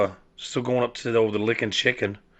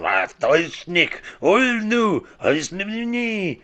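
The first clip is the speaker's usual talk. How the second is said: high, drawn-out fake Russian-German wailing; mock Russian-German gibberish; 10 semitones higher, 3 dB louder, slower than usual. Screaming in traffic, high mock Russian-German gibberish. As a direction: holler fake Russian-German nonsense, pitch soaring. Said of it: "in Russian or something".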